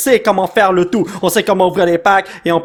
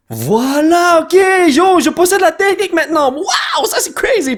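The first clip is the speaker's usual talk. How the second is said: excited; 8 semitones higher than usual. Real, not acted. Proud of new opening technique.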